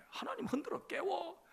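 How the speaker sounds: ordinary talk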